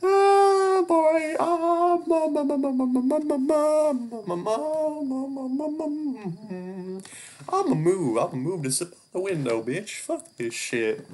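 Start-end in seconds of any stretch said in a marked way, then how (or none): 0.0-7.0 piercing high-pitched loud mamama, slowing and fading; high shrieky babble. Real, not acted. Startled player shrieks at the creepy scene, high and loud. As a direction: let out a shrill scared warble that runs down.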